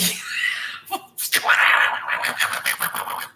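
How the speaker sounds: sputtering mock-angry duck squawk; Donald Duck tantrum. Imitating her twin getting mad, Donald Duck tantrum noise. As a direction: splutter like an enraged cartoon duck. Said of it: "This looks like Donald Duck".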